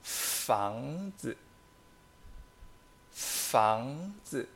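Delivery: fangzi, fang rising in second tone, zi light neutral; careful teacher demo of fangzi. Shown as a demonstration. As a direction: rise on fang, drop zi to neutral. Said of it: "segundo tono hacia arriba".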